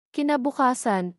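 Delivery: ordinary delivery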